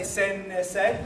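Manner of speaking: sen, ne, say as three beats; added syllable. Shown as a demonstration. Teacher having the class repeat the mistake, added syllable. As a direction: put an extra ne after sen. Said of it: "Don't add a syllable".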